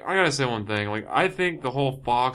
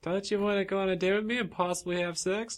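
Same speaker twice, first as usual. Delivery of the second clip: higher flirty voice; flirty woman; 7 semitones higher, 4 dB quieter than usual. Pretend. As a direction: coax him sweetly. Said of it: "acted dialogue".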